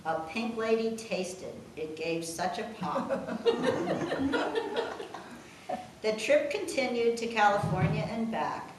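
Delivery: reading rhyming verse aloud; steady sing-song poem read; poem recital. Acted. Daughter reads the family poem to her mother, recital voice.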